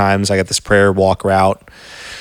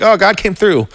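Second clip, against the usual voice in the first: higher, breezy mock-easy tone; glib mock cheer; 8 semitones higher than usual. Cohost mocking easy podcast faith talk, breezy mock cheer. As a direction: toss it off as if it were nothing. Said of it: "It's easy to sit here on a podcast and be like".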